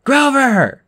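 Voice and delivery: high, loud call; shouting. A narrator's reading. Friends call to a boy absorbed in a game, shouting. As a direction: call his name sharply to get his attention. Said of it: "we both shouted".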